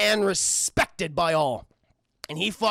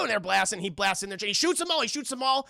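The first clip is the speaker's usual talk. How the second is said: ordinary talk, nothing marked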